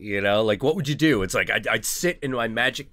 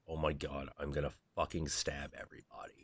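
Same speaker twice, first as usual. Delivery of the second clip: softer, unvoiced mock-murderous whisper; whispered mock threat; 5 semitones lower, 15 dB quieter than usual. S0 on hating New York, half-joking whispered threat. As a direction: hiss it under your breath like a fed-up commuter.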